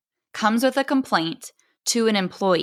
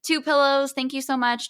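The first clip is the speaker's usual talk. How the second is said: pitched higher, quick polite brush-off; polite roll-back; 7 semitones higher than usual. Retold or imitated. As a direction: wave off the fuss sweetly, much higher up. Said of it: "you're rolling it back".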